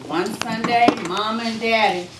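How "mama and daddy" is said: ordinary talk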